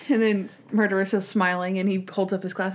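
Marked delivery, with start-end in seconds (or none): none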